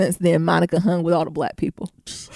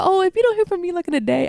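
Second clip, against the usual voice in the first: pitched way up, imitating the roommate; squeaky retold roommate line; lightly mimicking a sheltered roommate; high mock roommate voice; 9 semitones higher than usual.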